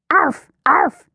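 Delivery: high, short puppy yaps; puppy bark. A narrator's reading. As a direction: yap like an eager little puppy. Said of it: "she barked".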